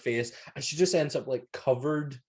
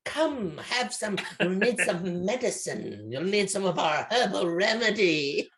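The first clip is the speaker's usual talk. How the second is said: high, hammed-up witch with laughter creeping in; witchy voice; 6 semitones higher than usual. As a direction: coax them like a storybook witch. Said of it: "witchy voice, and she's just like".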